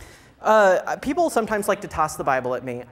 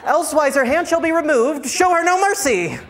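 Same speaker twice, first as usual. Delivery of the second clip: mock preaching; 8 semitones higher, 4 dB louder than usual. Mock scripture read, building.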